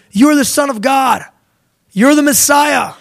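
high, ringing proclamation; excited declaration. Acted. Acts out Peter's excited revelation.

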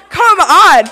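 loud, high retold outburst; amazed exclamation. Praying woman to the healed girl, loud amazed outburst. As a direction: belt it out in mock disbelief.

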